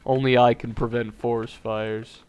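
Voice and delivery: ordinary delivery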